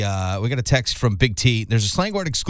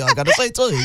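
put-on English-accent tea, higher; mock English accent; 8 semitones higher, faster than usual. Host insists on his tea, light English accent. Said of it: "Every time I say tea".

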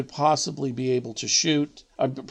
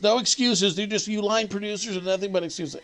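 higher, dismissive mock scold; imitating Mac scolding; 7 semitones higher than usual. Narrator imitates Mac scolding the young line producer, higher mock scold. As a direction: toss off the blame like a grumpy veteran.

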